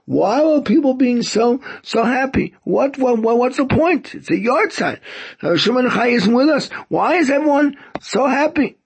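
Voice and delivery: retold protest, pitched high; bewildered protest. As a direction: voice the mourner's baffled, high-pitched questioning. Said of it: "he couldn't understand".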